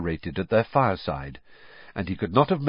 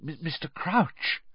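higher, softer, stammered breathless address; 8 semitones higher, 5 dB quieter than usual. A narrator's reading. Percy addressing his idol Crouch, breathlessly. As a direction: stammer it softly, out of breath with awe. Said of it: "said Percy breathlessly".

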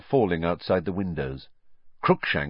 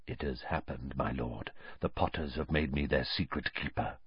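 hushed, eager murmur much softer; low excited mutter; 5 semitones lower, 9 dB quieter than usual. A narrator's reading. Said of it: "a low, excited mutter".

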